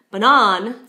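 "banan" stressed hard and loud on the second syllable; English-style misreading. Shown as a demonstration. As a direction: reduce "ba" to "buh", punch a short "nan" in "banan". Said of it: "tempted to read it in English".

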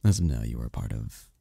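normal talking voice

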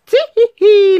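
loud, high gleeful tee-hee-hee; squealing tee-hee-hee laugh; high giggle. Acted. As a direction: giggle like a naughty cookie. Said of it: "laughed the gingerbread man".

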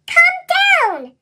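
high, loud call up to the ship; piercing high cry for a friend; urgent whistle call. A narrator's reading. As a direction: shout up, pleading. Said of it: "Thomas whistled".

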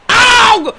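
very high, loud cry; urgent shout. Real, not acted. Gamer watching the ball in play, urgent shout. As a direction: yell out at the runner. Urging runner on in game.

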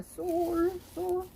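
half-sung scale landing high on a drawn-out note; sol tone. Shown as a demonstration. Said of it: "솔 톤이 좋다고".

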